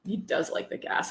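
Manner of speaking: whispered "He does like the gas."; a whispered conspiratorial aside. Real, not acted. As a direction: drop to a whisper for the joke.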